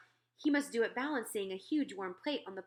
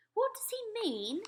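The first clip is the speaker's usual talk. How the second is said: much higher, slow royal question; puzzled Queen; 13 semitones higher, slower than usual. Acted. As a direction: ask Sophie, baffled.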